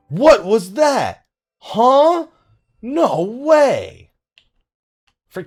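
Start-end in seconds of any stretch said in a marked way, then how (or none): none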